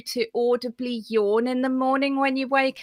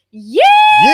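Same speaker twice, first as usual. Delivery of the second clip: a very high, loud shouted yee-haw; shouting along with the class as a cowboy; full cowboy yee-haw; 13 dB louder than usual. Real, not acted. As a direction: yell it like a cowboy swinging the lasso. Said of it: "Get that lasso going".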